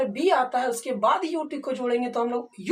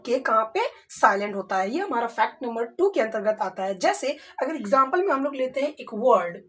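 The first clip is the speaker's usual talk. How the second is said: ordinary talk, nothing marked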